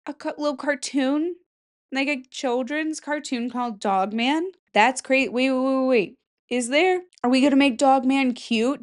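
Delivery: ordinary talk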